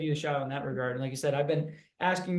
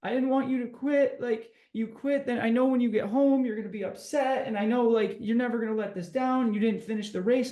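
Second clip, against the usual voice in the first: higher, pleading mock wife voice; lightly voiced tearful wife, pitched up; imitating upset wife; 7 semitones higher than usual. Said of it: "She's like".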